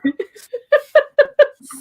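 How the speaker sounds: real laughing fit; loud high laugh. Real, not acted. Laughing at her own joke.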